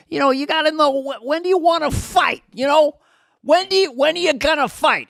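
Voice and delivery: high, excited tough-guy rant; mock Pesci. Imitating Joe Pesci's rant, high and excited. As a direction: rant like a little New York tough guy.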